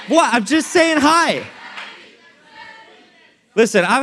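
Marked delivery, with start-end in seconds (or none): 0.1-1.4 high, drawn-out innocent protest; teasing high-pitched defensiveness; mock innocence. Real, not acted. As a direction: protest loudly that you meant no harm. Mock-innocent protest to scared man.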